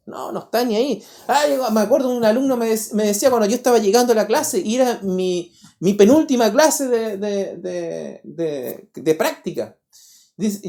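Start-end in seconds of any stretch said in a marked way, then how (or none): none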